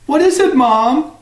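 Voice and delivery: put-on high young boy's voice. Jack answers his mother in a high boy's voice. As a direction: answer like an eager little boy.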